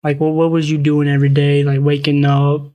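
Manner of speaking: ordinary delivery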